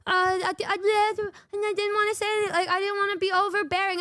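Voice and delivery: squeaky, hesitant imitation of the friend; high sheepish friend impression. Quoting shy friend to viewers in high sheepish voice. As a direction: do her bashful stammering excuse. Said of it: "And she said".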